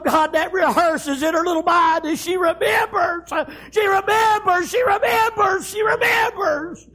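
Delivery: anguished, high shouted repetition; full-force preaching. Real, not acted. Preacher picturing the girl's memories, anguished shouting. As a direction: climb high and wail the repeated phrase.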